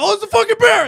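high, very loud alarmed yell; panicked shout. Retold or imitated. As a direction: shout it like you've just spotted danger. Acting the troopers spotting a bear.

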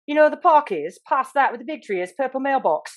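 put-on improvising nervously in a British accent; panicked fake British. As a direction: bluff directions in fake British. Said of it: "so I just panicked".

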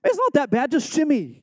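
high, taunting call back down the tunnel; mock-impatient coaxing; teasing teammates. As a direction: needle the big guys to hurry. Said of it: "I'm looking back like".

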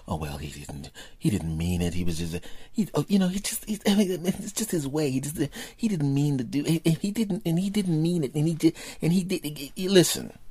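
high, whiny, stammering excuses; mocking excuse-maker. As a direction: parody a flustered apologist. Mocking people making excuses.

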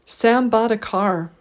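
Sam, bought, car stressed, a unstressed; deliberate three-beat Sam bought a car; three stresses, one weak. Shown as a demonstration. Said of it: "three stressed syllables and one unstressed syllable".

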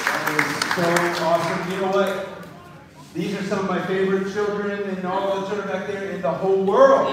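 loud, enthusiastic gushing; excited praise. Real, not acted. Pastor praising the children to a cheering church, loud and excited. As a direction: build to a big shout on the last word.

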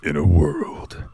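low, slow trailer announcer voice; movie trailer narrator. Pretend. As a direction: open it like an epic movie trailer. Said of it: "Let me get my narrator voice on".

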